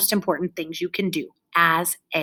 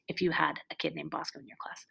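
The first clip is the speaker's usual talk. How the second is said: whisper; 3 semitones lower, 12 dB quieter than usual. Real, not acted. Aside whispered.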